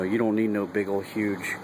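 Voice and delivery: ordinary delivery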